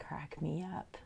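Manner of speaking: soft aside whisper. Real, not acted. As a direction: whisper it breathily under the breath. Said twice, whispered then aloud.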